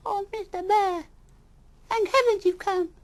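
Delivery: very high, loud relieved creature; relieved cry. Acted. Animal greeting rescuer bear, very high relieved cry. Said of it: "cried a voice".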